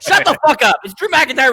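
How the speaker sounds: loud, high, heated rant at his friends; mad outburst. Real, not acted. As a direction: blow up at the hypocrisy, half joking. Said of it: "Hoes mad".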